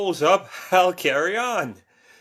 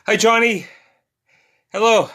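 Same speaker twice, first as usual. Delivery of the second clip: higher, louder hellos; excited greeting; 5 semitones higher, 5 dB louder than usual. Real, not acted. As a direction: greet them brightly. Greeting viewers joining.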